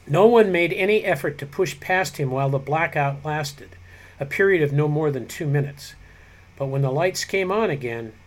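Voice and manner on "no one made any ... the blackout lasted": ordinary delivery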